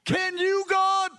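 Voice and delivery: aghast at himself, pleading; shocked disbelief. Retold or imitated. Young believer to God after sinning, shocked high cry. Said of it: "I was shocked".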